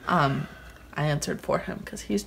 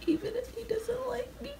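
hushed high whisper; 8 dB quieter than usual. Real, not acted. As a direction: breathe it as a sad aside. Mock pitiful aside to camera.

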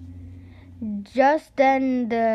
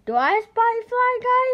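put-on higher hopeful boy's ask; hopeful question; 12 semitones higher, 5 dB louder than usual. Boy checking a hiding fly, higher and hopeful. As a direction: ask it hoping it's him.

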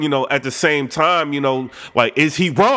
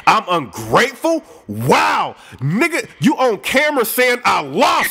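outraged shout; 6 semitones higher, slower than usual. Retold or imitated. [name] fires back at [name] with a high outraged yell. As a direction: explode at the insult. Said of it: "So [name] is pissed".

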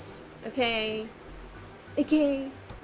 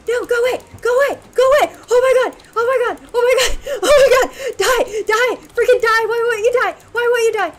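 panicked high screaming; scared horror game streamer; 10 semitones higher, 10 dB louder, faster than usual. Real, not acted. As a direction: shriek in terror at the monster. Panicked fight with monster.

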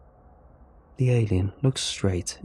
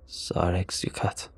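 low, slow, quiet alien words; 3 semitones lower, 6 dB quieter, slower than usual. A narrator's reading. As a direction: murmur it like an alien.

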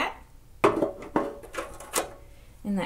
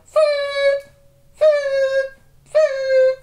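high puffed toots into a party blower; 8 dB louder, much slower than usual. Shown as a demonstration. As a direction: puff out three sharp toots. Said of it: "but you go".

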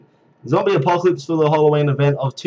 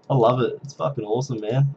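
ordinary talk, nothing marked

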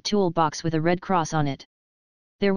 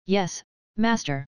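robot voice; slower than usual. A narrator's reading. Robot answers master.

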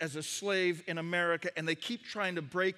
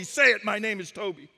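loud, forceful shouted demand; commanding slave-whipper; 3 semitones higher, 8 dB louder than usual. Acted. Whipper demanding the slave name from the chief, commanding. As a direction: force the words on him. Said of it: "once again, he says".

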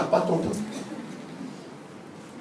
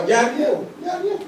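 mock sulky denial; 8 semitones higher, faster than usual. Sulking member denies, laughing.

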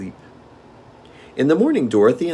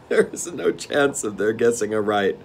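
plain reading voice

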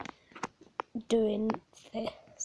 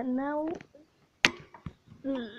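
normal talking voice

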